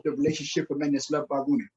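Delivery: ordinary talk, nothing marked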